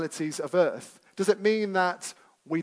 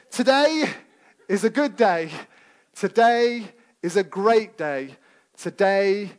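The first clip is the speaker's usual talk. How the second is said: put-on American accent; 3 semitones higher, 5 dB louder than usual. Preacher imitating the American school-run mantra. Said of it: "I'm normally good on accents".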